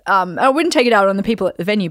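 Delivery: normal talking voice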